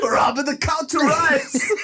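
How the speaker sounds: laughing. Real, not acted. Laughing hard.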